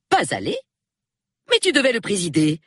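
high, rising disbelief, then quick protest; astonished echo. A narrator's reading. Wife learning he missed the meeting, astonished.

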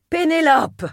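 sharp, high call of the name; a high cutting summons; name cracked like a whip. A narrator's reading. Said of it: "Son nom claqua comme un fouet".

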